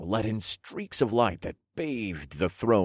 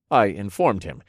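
usual speaking voice

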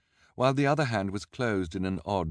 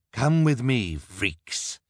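much higher, louder commanding villain; villain ordering the orphans along; Olaf ordering; 6 semitones higher, 6 dB louder, much slower than usual. A narrator's reading. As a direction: bark the order. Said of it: "Count Olaf ordered".

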